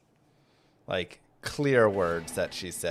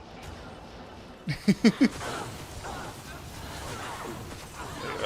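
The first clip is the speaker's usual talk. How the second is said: high breathy laugh; 13 semitones higher than usual. Real, not acted. Laughing at daughter's donut word.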